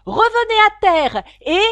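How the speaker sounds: very loud, high command; full-force yell. A narrator's reading. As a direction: yell the order at full power. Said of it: "hurla le griffon de toutes ses forces". (hurler l'ordre à pleins poumons)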